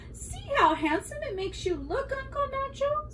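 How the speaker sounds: high-pitched, delighted niece voice; storybook niece, pitched well up; admiring niece. A narrator's reading. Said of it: "said Ambrosia".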